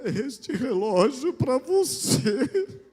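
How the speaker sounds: choking on tears while handing the watch over; sobbing. Retold or imitated. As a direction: blubber the words out like the grieving widow did. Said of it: "eu soluçava que nem a viúva".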